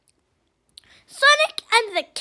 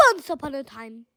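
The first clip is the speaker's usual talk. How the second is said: plain reading voice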